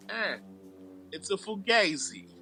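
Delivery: lightly playing Pacino's Italian mobster; an Italian-American wiseguy accent, understated. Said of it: "Well, I will try it right here".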